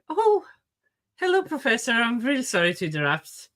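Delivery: high, loud startled hello; student startled then polite; surprised polite greeting. Acted. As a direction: jump in with an eager hello.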